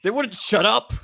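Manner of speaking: high, loud in-character complaint; loud acted exasperation. Player complains about the voices in their head, loudly exasperated. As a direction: cry out, fed up with them.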